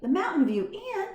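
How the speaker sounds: high, startled question; surprised. A narrator's reading. Boy surprised the mouse lived at the inn. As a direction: echo the name in disbelief. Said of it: "Garf was surprised".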